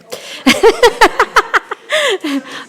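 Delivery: bursts into a high, real laugh; a sudden amused laugh breaking out. Real, not acted. Councillor laughing as the planner looks for her, high real laugh. Caught speaking from unseen seat.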